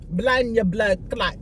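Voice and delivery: slow mock Jamaican patois; drawn-out imitated Jamaican accent. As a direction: savor the curse in a put-on Jamaican accent. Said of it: "That was my accent".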